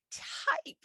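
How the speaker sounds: imitating her stunned reaction; incredulous. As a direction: shoot the word up in disbelief. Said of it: "And I'm like".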